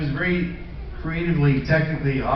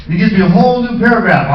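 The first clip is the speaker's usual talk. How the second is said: ordinary delivery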